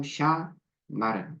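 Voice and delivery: "샤마르"를 아주 느리게, 마지막 음절 "르"에 힘; 천천히 "샤마르", 끝 "르"를 강조; 끝음절 강세. Shown as a demonstration. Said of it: "강세가 항상 마지막 음절에 간다". (끝음절 강세 시범, 아주 느리게)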